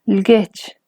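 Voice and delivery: "Lgech" on a low tone. Shown as a demonstration. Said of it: "Ahora unos ejemplos con tono bajo".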